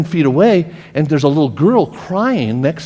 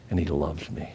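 ordinary talk

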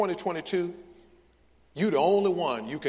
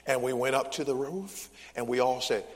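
ordinary delivery

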